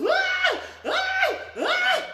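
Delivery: three loud, very high shrieks; imitated child's screams. Boy balled up in a corner, imitated piercing screams. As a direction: shriek in bursts like a child in a tantrum. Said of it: "balls up and just goes".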